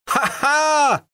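high mock laugh; an elf finds the drunk butler and laughs, pitched high. A narrator's reading. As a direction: crow with delight at finding him. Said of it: "came a cry".

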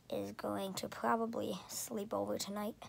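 ordinary delivery

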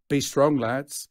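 slow, loud rallying line; steady the lads; a boss rallying his gang; rallying call. Acted.

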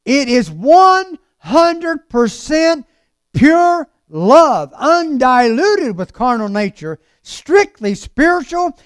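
drawn-out, swelling pulpit delivery; impassioned preaching. Acted. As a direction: stretch the big words, preach them out. Preaches at full force.

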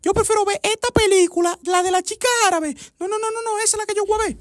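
put-on a high squeaky girlfriend voice; high girly voice. Girlfriend refusing zombie films in a high squeaky voice. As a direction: pipe it up high like a fussy young woman.

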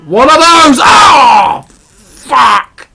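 loud, high outburst; full-voiced swearing shout; startled yell. Real, not acted. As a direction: scream out in shock at the game. Yelling at sudden game event.

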